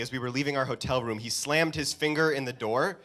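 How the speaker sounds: usual speaking voice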